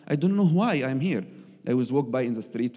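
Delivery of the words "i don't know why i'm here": high, loud pleading complaint to God; lost and angry, begging God for answers; complaining prayer. Retold or imitated. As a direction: cry out to God in frustration. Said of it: "very complaining, angry".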